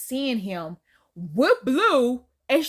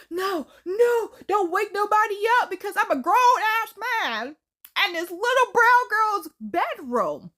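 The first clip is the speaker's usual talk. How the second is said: panicked hushing; 10 semitones higher, 4 dB louder than usual. Retold or imitated. Panicked man hushing creature.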